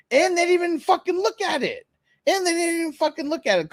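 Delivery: high, exasperated complaint; fed-up exasperation; exasperated rant. Real, not acted. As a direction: vent it in disbelief. Venting about the mechanic shop.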